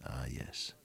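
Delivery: breathy whisper, almost to himself; whispered ah yes. Real, not acted. Preacher affirms his own point under his breath. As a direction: let it slip out as a hush. Quiet aside after question.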